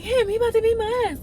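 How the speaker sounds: light imitation of a scared girl; frightened girl. Girl insists on threat.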